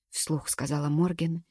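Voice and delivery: ordinary delivery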